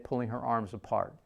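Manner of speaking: ordinary delivery, nothing marked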